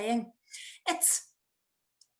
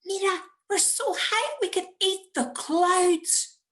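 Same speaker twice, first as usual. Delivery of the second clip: put-on higher, brighter child's voice; excited child; 10 semitones higher, 5 dB louder than usual. Nephew gazing out the car window, excited child voice. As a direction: play an excited six-year-old.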